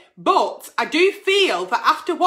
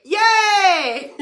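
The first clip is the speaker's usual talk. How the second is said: cheering; 3 semitones higher than usual. Real, not acted. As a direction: celebrate with a whoop and a laugh. Cheering her weight loss.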